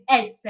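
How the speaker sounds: "S" said in two syllables, "ESS-eh"; "S" with an added "eh" at the end; Italian letter name. Shown as a demonstration. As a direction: say "S" as Italian esse. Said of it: "S is pronounced like this".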